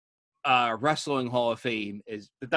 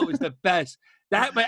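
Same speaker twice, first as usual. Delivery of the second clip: ordinary delivery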